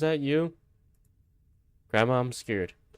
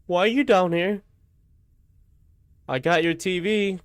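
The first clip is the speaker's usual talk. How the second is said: surprised; 8 semitones higher, 4 dB louder than usual. Real, not acted. Startled by grandma in basement.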